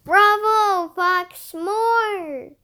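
high, loud bravo with drawn-out words; loud theatrical cheer; cheering audience. A narrator's reading. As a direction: call out like an applauding crowd. Imagined audience cheering Fox.

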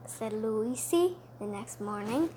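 plain reading voice

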